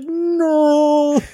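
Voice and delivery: high drawn-out cry of horror; mock wail. Friends in the van watching the spray, slow-motion wail. Everyone reacting to pee spray.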